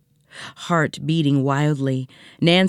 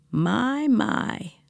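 raspy voice; 7 semitones higher than usual. Acted. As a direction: rasp it out slowly. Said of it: "said a raspy voice".

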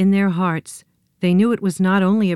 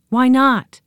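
louder, higher decisive why not; firm exclaimed agreement; 7 semitones higher, 6 dB louder than usual. A narrator's reading. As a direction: say it with conviction. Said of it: "she said".

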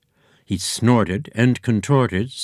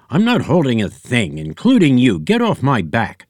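loud, higher angry pig snarl; 4 semitones higher, 4 dB louder than usual. A narrator's reading. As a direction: snarl at the clown on your back.

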